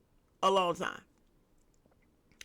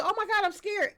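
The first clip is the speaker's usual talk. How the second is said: mock fear; 10 semitones higher than usual. Voices a frightened stranger.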